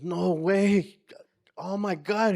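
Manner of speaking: high, stunned no way; floored by the reveal; stunned disbelief. Retold or imitated. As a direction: mentor realizing the boy in front of him is Omar. Man learns Omar is him.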